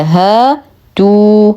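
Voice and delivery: هاتوا with "haa" and "tuu" held equally long; evenly timed long vowels. Shown as a demonstration. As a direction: chant هاتوا slowly, balancing both long vowels. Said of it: "نوازن أزمنة المدود الطبيعية". (موازنة المدين في هاتوا)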